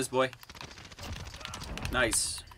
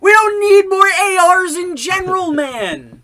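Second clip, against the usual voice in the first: exasperated yelling; 11 dB louder than usual. Real, not acted. Player ranting about new guns, exasperated yelling. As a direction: shout each word out in frustration.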